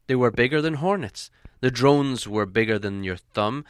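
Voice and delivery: plain reading voice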